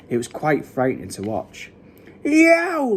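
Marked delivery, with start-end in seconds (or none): 2.2-2.9 high-pitched howl of pain; roar. A narrator's reading. Sleeping giant in a nightmare, roaring. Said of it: "roared the flesh lump eater".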